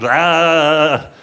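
loud blank ah of shock; stunned ah. Retold or imitated. Clerk's speechless gasp at the grieving father, stunned. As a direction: let out a bewildered ah. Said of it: "I kinda just went".

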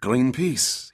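a stern French sailor, growling the question; French accent, lightly voiced by the narrator. A narrator's reading. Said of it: "he asked in a French accent".

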